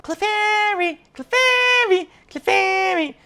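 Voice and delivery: very high, squeaky creature cry; Clefairy impression. Fan mimicking a cartoon Pokemon's cry, squeaky and high. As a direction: chirp the name in a tiny high-pitched voice.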